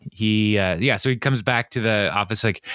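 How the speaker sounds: ordinary talk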